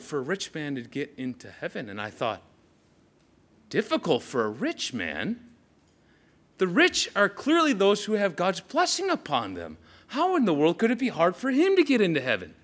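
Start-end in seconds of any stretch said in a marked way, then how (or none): 3.7-12.5 puzzled, then rising into high-pitched disbelief; baffled protest, climbing higher; incredulous questioning. Acted. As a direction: question it as if it overturns all you believed. Acting out his puzzled inner reaction.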